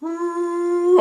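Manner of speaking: high ooh on leaking breath; leaky falsetto ooh. Shown as a demonstration. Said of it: "Now I want to go".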